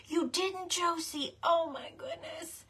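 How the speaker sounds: normal talking voice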